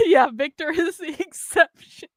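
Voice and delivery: slow words dissolving into laughter; giggling, barely getting it out; real laughing. Real, not acted. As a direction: laugh helplessly through the line. Laughing at her own exception.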